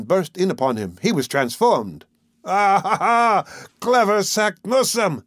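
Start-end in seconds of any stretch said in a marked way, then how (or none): 2.4-5.2 high, exultant outburst; hammed-up roar of discovery; triumphant cry. A narrator's reading. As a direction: crow it in revelation, pitch soaring. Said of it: "broke out into a cry".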